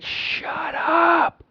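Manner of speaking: very high, strained mock yell; imitated angry shout. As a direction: mimic a screamed command without full volume. Retelling shooter yelling at victim.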